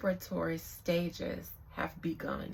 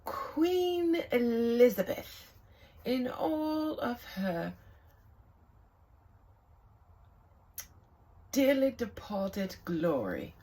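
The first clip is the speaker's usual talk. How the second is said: slow, grand mock posh British voice, higher and louder; mock British accent; 4 semitones higher, 7 dB louder, slower than usual. Vlogger mocking the late queen at a tarot reading, hammy British accent. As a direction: drawl the title like a hammy British royal, in a British accent. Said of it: "stop using that accent".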